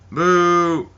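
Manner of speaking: lightly put-on jeer; mock crowd boo. Crowd answering John Cena's name, mock booing. Mimics crowd reacting to the names.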